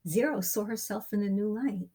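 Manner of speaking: plain reading voice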